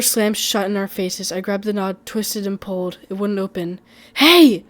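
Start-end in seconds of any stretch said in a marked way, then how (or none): 4.1-4.6 high, loud panicked yell; scream. A narrator's reading. Trapped narrator screams at the door. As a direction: scream for help at the locked door.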